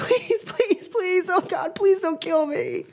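very high squeaky pleading; begging hostage. Acted. Ezra begging the villain not to kill him, high squeal. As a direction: beg like a panicked prisoner. Ezra begs for his life.

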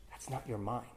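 low, very soft retold rebuke; soft, low voiced storytelling punchline; hushed correction. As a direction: drop right down as a teacher quietly correcting. Voices Buddha correcting Ananda.

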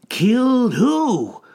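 high, slow, loud question; drawn-out incredulous cry; urgent disbelief. A narrator's reading. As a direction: demand it in stunned disbelief. Greenie presses for the answer.

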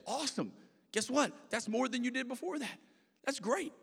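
high-pitched, delighted cheering on; excited encouragement. Acted. Pastor cheering a member's small fast, excited encouragement. Cheering on someone who fasted once.

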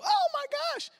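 stunned high exclamation; astonished cry. Retold or imitated. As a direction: burst out in wonder. Reaction to woman's bold declaration.

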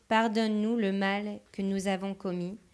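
ordinary talk

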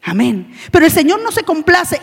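ordinary delivery

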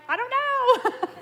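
high, laughing mock husband voice; amused shrug; laughing reply. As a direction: laugh it out, baffled. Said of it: "He's like".

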